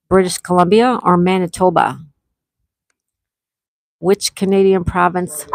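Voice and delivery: ordinary delivery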